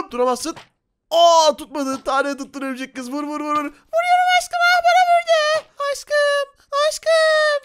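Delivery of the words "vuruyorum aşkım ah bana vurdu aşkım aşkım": put-on squeaky high-pitched spider girl, slow and sweet; girly high spider girl voice. Spider girl shooting, gets hit. (çok ince sesli örümcek kız)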